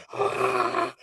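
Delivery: very high, unforced mock crowd scream; imitated crowd going nuts. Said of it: "they're going nuts like".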